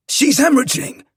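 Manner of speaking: high, loud alarm; doctor yelling out. A narrator's reading. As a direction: shout the emergency across the room. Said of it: "one of the doctors yelled out".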